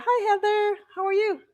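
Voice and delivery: sudden high-pitched greeting; voice going up high. Real, not acted. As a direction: jump way up in pitch to say hi. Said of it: "my voice went up high like that".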